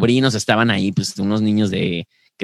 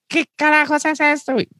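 put-on shocked kid voice. Nephews shocked at cockfight.

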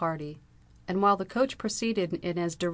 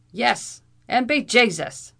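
high, loud Irish outburst; vociferating; 6 semitones higher, 9 dB louder, slower than usual. A narrator's reading. Irish captain bellows at the intruders. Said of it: "vociferated Captain O'Blunderbuss".

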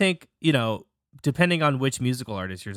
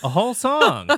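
surprised; 11 semitones higher, 5 dB louder than usual. Real, not acted. Surprised at whole song.

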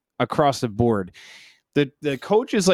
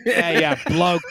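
light Australian sign-off; Australian accent; slower than usual. Acted. Co-host finishing his requested bit, Australian accent. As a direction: round off the fake Australian bit. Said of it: "Can you do an Australian accent?".

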